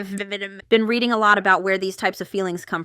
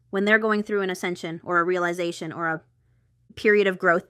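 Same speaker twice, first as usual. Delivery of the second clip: ordinary talk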